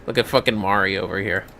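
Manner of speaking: ordinary talk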